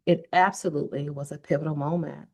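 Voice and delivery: ordinary delivery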